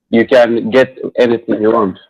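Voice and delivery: ordinary delivery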